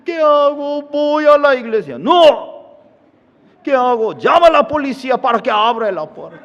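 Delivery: high, loud, desperate pleading; pleading wife. Acted. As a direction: beg the pastor in a high frantic voice.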